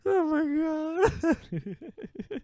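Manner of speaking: high drawn-out laughing wail; laughing cry. Real, not acted. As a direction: let it wail out between laughs. Laughing at goblin lore.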